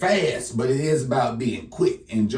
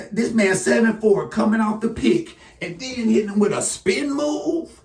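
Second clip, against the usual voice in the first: rising, much higher, louder hype; excited hype; 10 semitones higher, 3 dB louder than usual. Real, not acted. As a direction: hype it up, climbing in pitch. Hyping a rookie's moves.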